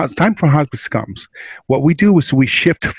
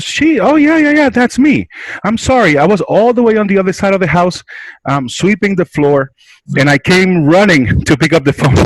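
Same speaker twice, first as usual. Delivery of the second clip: imitating a breathless lady, much higher and louder; patient answering the hospice call, breathless and much higher; flustered lady; 8 semitones higher, 3 dB louder than usual. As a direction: gush out a rushed, high apology as the caller's patient. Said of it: "And she goes".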